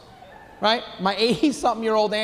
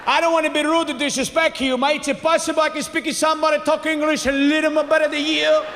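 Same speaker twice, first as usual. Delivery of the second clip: put-on heavily accented, worked-up father; father flipping out; 7 semitones higher than usual. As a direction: flip out like an indignant immigrant dad. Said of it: "my father flipped out".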